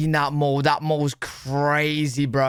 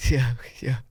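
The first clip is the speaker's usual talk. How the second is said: whispered aside; 4 semitones lower than usual. Real, not acted. Whispered aside while laughing.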